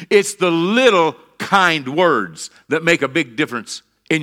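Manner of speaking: loud, high-pitched pulpit delivery; forceful preaching. Real, not acted. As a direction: drive each point home to the congregation.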